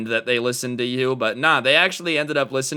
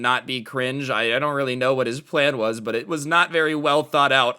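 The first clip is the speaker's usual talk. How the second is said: plain reading voice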